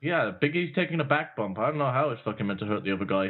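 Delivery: ordinary talk, nothing marked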